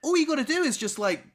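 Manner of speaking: high, loud plea; pleading. Retold or imitated. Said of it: "and I was like".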